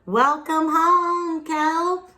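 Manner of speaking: friends' welcome. Acted. Narwhal friends greeting Kelp back home, high and slow. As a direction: draw it out like a happy welcome.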